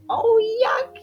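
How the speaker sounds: a high grossed-out cry; disgusted yuck. Acted. Child feeling a sticky web on its cheek, high disgusted squeal.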